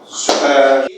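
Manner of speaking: ordinary talk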